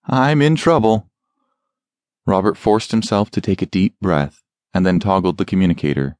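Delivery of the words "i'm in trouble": panicked. Acted. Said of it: "he nearly panicked".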